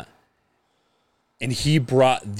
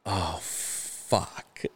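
hushed dread; 5 semitones lower, 11 dB quieter than usual. Retold or imitated. Retold dread as friend stands up.

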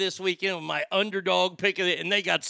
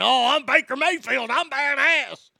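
higher, mocking cocky quarterback voice; mocking Baker Mayfield; 7 semitones higher, 4 dB louder than usual. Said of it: "get all fucking worked up".

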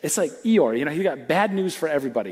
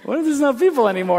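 higher, quick mock voice of God; God's voice; 8 semitones higher, 5 dB louder, faster than usual. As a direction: toss it off as God teasing. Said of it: "And then God's like".

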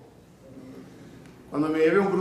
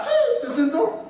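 put-on very high, slow falsetto girl through laughter; giggling falsetto girl; laughing high girl voice; slower than usual. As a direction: squeak it out like the girl while laughing.

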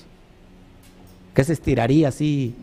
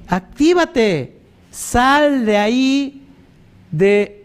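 slow, loud, higher, each word drawn out; urgent exhortation; 7 semitones higher, 4 dB louder, much slower than usual. Real, not acted. Preacher urges believers to wake up and leave, slow loud call. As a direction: drag out every word like a rousing call.

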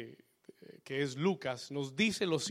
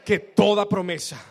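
shouted declaration of faith; 3 semitones higher, 12 dB louder than usual. Shown as a demonstration. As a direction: belt it out, much louder.

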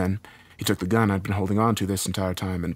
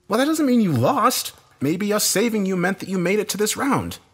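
high-pitched, insistent rebuttal; arguing; 13 semitones higher, 4 dB louder than usual. A narrator's reading. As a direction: argue back with urgency. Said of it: "I argued".

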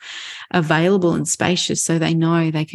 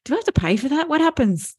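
lightly voiced puzzlement, pitched up; higher, mock-clueless questioning; puzzled young adult; 9 semitones higher than usual. Young adult leans on mom for guidance, lightly puzzled.

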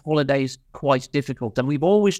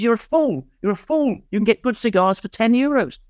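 higher, mock exasperated friend; scolding friend; 8 semitones higher than usual. Friend scolding him for pricey cigars, mock exasperated. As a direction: tell him off like a friend who knows better.

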